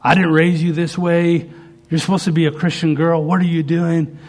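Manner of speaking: mock yell of a parent at a wayward daughter; retold parental yelling. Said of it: "He'd yell at her".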